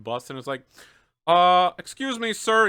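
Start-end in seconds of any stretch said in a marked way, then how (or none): 1.3-2.7 higher, louder officer calling out; polite but pointed, higher and louder; police officer. Acted.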